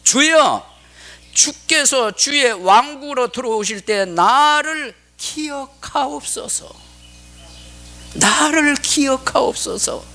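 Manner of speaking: high, slow, drawn-out entreaty; solemn plea. Acted. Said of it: "그러면서 요청한 말이 그 다음에". (높고 느리게 간절히 청하듯)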